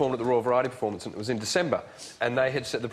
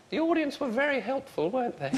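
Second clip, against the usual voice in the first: higher, prim royal voice; a dry royal dig, pitched up; lightly hammed-up Queen; imitating the Queen; 11 semitones higher, 4 dB quieter than usual. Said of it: "She said".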